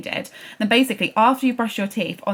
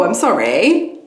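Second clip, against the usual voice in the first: normal talking voice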